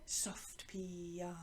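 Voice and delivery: slow, very hushed chant; low, soft, drawn-out murmur; soft piano. Shown as a demonstration. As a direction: drop right down to almost nothing. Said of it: "Soft is piano".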